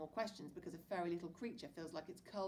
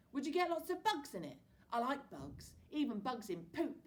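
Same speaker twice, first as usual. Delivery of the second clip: put-on higher, excited little boy; a small boy delighting in bugs; Billy; 7 semitones higher, 6 dB louder than usual. A narrator's reading. Little brother to big brother, lightly voiced excited boy.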